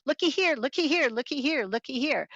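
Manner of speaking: high lilting birdsong chant, stepping down; yellowthroat song. Shown as a demonstration. Birding teacher imitates yellowthroat song, high and lilting. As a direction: call it like a little bird, high then falling. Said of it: "The common yellow throat".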